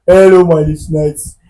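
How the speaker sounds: mocking radio voice. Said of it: "That is how they talk".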